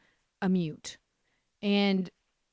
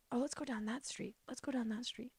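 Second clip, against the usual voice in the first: soft, higher-pitched mock driver voice; hushed role-play; 4 semitones higher, 11 dB quieter, faster than usual. Driver picking the next street to perfume, hushed and acted out.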